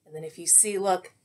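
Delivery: plain reading voice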